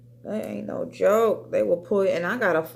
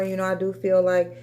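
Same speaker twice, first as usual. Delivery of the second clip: ordinary talk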